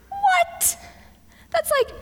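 high-pitched squeak of disbelief; incredulous. Pretend. Preacher reacting to God cutting Gideon's army, incredulous. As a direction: squeal it, baffled by the order. Mock disbelief at God's order.

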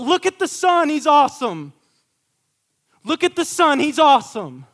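loud, high and exuberant cheer, a light acting of the Spirit; a lightly voiced excited herald; Spirit's voice. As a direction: call out like a proud fan pointing at a hero. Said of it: "'Cause the Spirit's all about".

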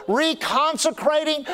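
ordinary talk, nothing marked

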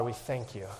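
normal talking voice

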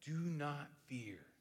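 soft, slow comforting words; tender reassurance. Acted. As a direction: say it gently as God would. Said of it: "you said".

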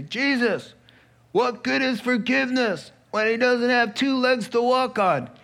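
a mild mock heckle at Jesus; heckling. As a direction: call down a skeptical challenge. Dude above heckles Jesus.

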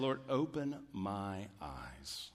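soft, slow, low plea to God; hushed, unhurried prayer; humble prayer. Acted. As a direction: pray it quietly and slowly, humbly dependent. Acts psalmist's humble prayer after boast.

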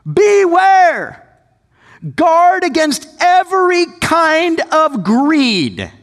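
high, loud, slow warning; stern warning. Acted. Jesus warns the crowd against greed, loud and slow. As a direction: sound the alarm, slowly.

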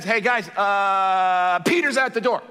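lightly acted dazed messenger; stunned kid announcing. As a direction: stall on a held uh before the news.

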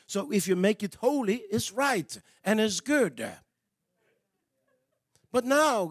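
usual speaking voice

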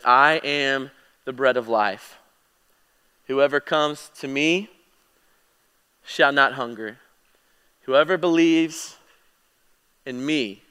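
slow, hushed, weighty reading with long pauses; solemn scripture reading. Acted. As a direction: let each phrase land slowly and reverently.

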